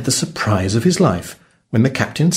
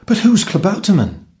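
put-on high-pitched boy's question; higher, curious young lad; puzzled cabin boy; 10 semitones higher than usual. A narrator's reading. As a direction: ask it in boyish bewilderment. Puzzled boy asks the cook.

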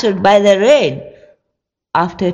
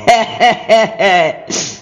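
cackling witch laugh; witch cackle. Acted. Baba Yaga cackles at Ivan, witch laugh. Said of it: "exclaimed the witch".

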